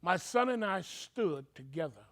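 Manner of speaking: ordinary delivery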